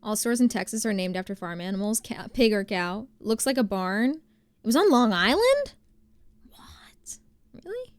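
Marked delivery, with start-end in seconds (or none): 4.6-7.9 breathy "What?" then squeaky high "Really?"; surprised. Real, not acted. Hearing a viewer is from Long Island, surprised. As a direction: gasp it, then shoot up in pitch.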